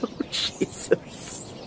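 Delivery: slow, breathy disbelieving laugh; a hollow laugh that collapses into the words; broken laugh. Acted. Widow laughs in shock at the news, hollow breathy laugh.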